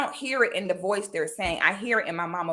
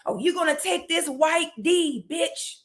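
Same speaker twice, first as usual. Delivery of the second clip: mocking harasser; 7 semitones higher than usual. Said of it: "people messaging you like".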